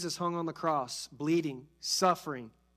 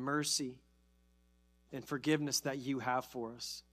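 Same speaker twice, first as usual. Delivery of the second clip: soft, quieter prayer; 5 semitones lower, 6 dB quieter than usual. Acted. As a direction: pray gently with bowed head.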